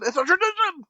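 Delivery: high, mock-exasperated dad voice; insistent dad. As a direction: play a dad stubbornly overruling the kids. Dad overrules the protesting kid.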